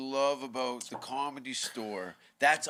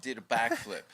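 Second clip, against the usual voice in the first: ordinary talk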